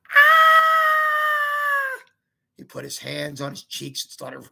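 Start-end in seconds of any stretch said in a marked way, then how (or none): 0.1-2.0 high startled "Ah!"; kid's shriek. Retold or imitated. Boy insisting to mom it wasn't a scream, shrieking. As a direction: show it, full high shriek. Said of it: "It wasn't a scream".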